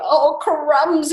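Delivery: high, loud mock dismay; alarmed snake. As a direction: exclaim in storybook fright. Snake frightened by Gruffalo.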